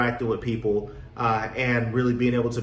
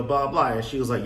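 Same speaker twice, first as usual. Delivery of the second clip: usual speaking voice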